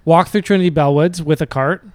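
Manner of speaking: normal talking voice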